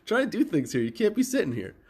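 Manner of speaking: high, sing-song voice for the cat; talking to the cat. Retold or imitated. Owner scolding his cat off the keyboard, high pet voice. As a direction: coo at her in a high pet voice. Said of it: "I go".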